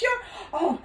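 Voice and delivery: high, loud, crying out; shocked realisation. Acted. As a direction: gasp as you recognise her. Father recognises daughter, cries out.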